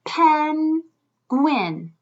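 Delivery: Penguin split with a clear gap; syllable by syllable. Shown as a demonstration. Teacher counting syllables with children, split apart. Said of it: "Let's feel it and count his syllables".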